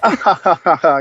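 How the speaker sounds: a light mock giggle, retold; imitating her laugh. Imitating the girlfriend's laugh to the host, light mock giggle.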